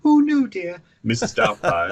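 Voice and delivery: high, loud, fussy Scottish nanny voice; hammed-up high old-lady voice; Mrs Doubtfire. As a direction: squeak it out like an elderly nanny. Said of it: "I could do the".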